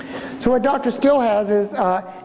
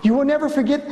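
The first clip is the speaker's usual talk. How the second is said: usual speaking voice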